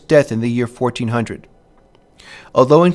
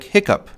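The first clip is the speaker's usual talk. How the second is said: "hiccup" stressed on the first syllable; trochaic stress; 8 semitones higher than usual. Shown as a demonstration. Lecturer giving an example of trochaic rhythm, "hiccup" stressed on "hic". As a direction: say "hiccup" strong then weak. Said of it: "hear the rhythm of the trochee".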